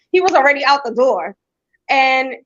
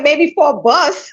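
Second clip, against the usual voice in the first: laughing through a slow, higher mock reply; laughing retort; 5 semitones higher, 4 dB louder, slower than usual. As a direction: tease him while laughing. Mom mocks son's gas money.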